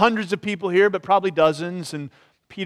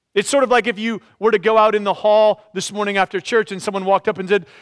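ordinary talk, nothing marked

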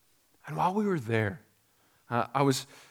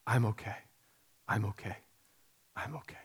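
self-soothing; 4 semitones lower, 9 dB quieter, faster than usual. Retold or imitated. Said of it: "she said".

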